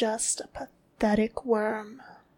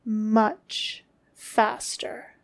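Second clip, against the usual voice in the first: slow drawn-out command; much slower than usual. Acted.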